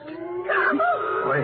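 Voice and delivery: distant wail. Acted. Sudden cry from the cave.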